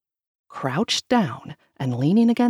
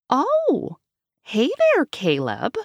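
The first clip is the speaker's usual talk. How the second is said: sing-song; 8 semitones higher, slower than usual. Acted. Grandma's falsely casual greeting.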